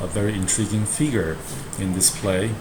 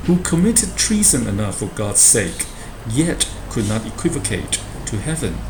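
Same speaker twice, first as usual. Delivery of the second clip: theatrical porter voice; 4 semitones higher than usual. Acted. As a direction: ham it up as the porter mocking a visitor. Teacher acts porter's speech.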